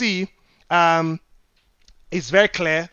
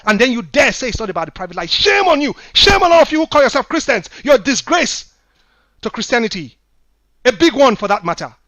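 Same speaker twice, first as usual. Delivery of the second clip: louder, higher fiery rebuke; emotional preaching; 5 semitones higher, 8 dB louder than usual. Real, not acted. Pastor rebuking churchgoers over a dead brother, loud and fiery. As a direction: thunder at the congregation like a grieving prophet. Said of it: "I'm quite emotional about this".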